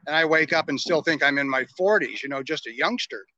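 usual speaking voice

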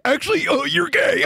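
high shouted taunt; mock friend yelling. Fattest friend blurting on the prank call, high shout.

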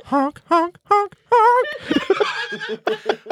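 high goofy honking sounds; silly clown honks. Acted. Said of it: "why are you making those weird sounds".

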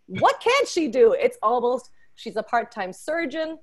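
ordinary talk, nothing marked

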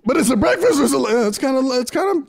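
high-pitched comic mother; mock mom. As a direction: play mom conceding, voice way up. Mimics mom answering the bit.

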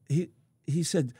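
usual speaking voice

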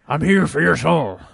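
loud gravelly devil voice; Satan voice. Retold or imitated. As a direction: boom it out like a Batman-style Satan. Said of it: "Satan sounds like Batman".

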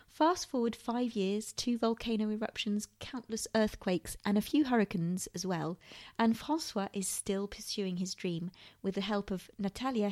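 scripted host intro, even and measured; podcast intro read. Acted. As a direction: read the show intro like an announcer.

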